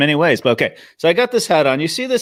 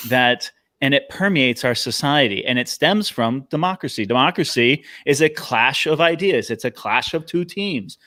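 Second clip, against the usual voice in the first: ordinary talk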